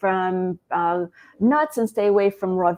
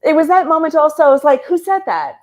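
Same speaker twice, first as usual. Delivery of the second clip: high, loud, bursting with disbelief; as if she can't believe she said it; amazed at herself; 7 semitones higher, 5 dB louder than usual. Real, not acted. Guest telling host Chris about her career shock, excited disbelief.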